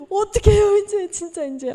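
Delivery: high, loud laugh into a helpless cry; missionary laughing helplessly at the broken bottles; despairing laugh. Retold or imitated. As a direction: laugh in disbelief, then cry out.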